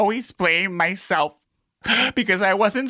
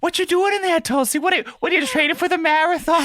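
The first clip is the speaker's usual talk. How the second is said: high, sing-song chat to a pet; talking to pet fish; 9 semitones higher than usual. Retold or imitated. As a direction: coo at the fish in its tank.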